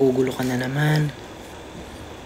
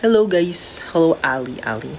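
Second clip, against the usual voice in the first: ordinary talk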